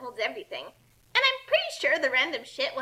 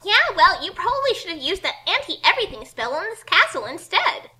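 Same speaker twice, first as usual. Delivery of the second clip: sassy retort; 6 semitones higher, 9 dB louder than usual. Acted. Kasumi retorting to villain.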